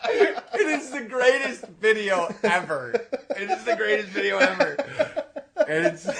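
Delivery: loud, high words through laughter; real uncontrollable laughter. Real, not acted. Teller praises his prank video, helplessly laughing. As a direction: struggle to talk while cracking up.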